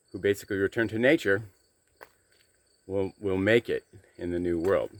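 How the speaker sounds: talking with coffee in mouth; words around a sip of coffee; mouth full. Real, not acted. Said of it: "sorry, I got coffee in my mouth".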